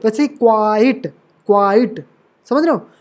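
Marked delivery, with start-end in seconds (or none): 0.3-2.1 quite with the आ drawn out; quite said slowly with a long stressed vowel; stressed syllable. Shown as a demonstration. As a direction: stretch the vowel in quite, light final ट. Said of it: "ये stressed syllable है। वैसे ही".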